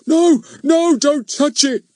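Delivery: very high, loud hammed-up cry; dramatic shout. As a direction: shout it like a melodrama. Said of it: "shouting dramatically".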